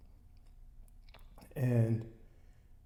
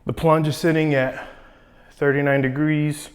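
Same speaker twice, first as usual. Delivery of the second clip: loud intro; 2 semitones higher, 7 dB louder than usual. Real, not acted. Intro filmed before cold plunge.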